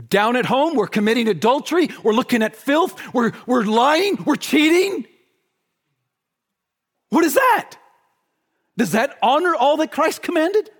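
high, loud preacher's rebuke; indignant outcry. Real, not acted. Preacher confronting the church over hidden sin, indignant. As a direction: cry out in rising outrage.

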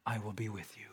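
very soft, low voice of comfort; hushed promise. Retold or imitated. Preacher voicing Christ's promise to those who fail, hushed. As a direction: drop low and soft to reassure.

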